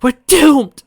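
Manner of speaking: high, loud cry of despair; panicked wail. Acted. Velvet losing it in the cage, panicked shriek. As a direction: shriek it as she loses it. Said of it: "Velvet finally lost it".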